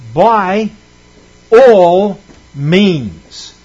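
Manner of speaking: "By" set apart by a pause, then "all means" slow and weighted on "all"; emphasized. Shown as a demonstration. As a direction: pause after "By", then lean hard on "all". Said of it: "I want to emphasize this here".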